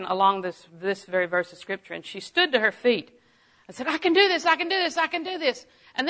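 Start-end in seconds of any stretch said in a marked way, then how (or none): 3.9-5.7 high-pitched, insistent repetition; eager resolve. Retold or imitated. Woman standing up in class to declare she can, eager resolve. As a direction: pump yourself up, pitched high.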